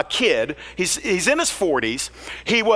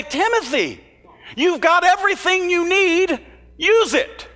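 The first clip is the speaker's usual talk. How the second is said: higher, urgent call to Timothy; rally him like a mentor, pitched up; Paul's exhortation; 9 semitones higher than usual. Acted. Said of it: "Paul, to come and say".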